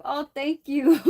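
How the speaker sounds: delighted thanks breaking into a loud laugh. Real, not acted. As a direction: thank them, then burst out laughing. Thanks viewer for gift membership.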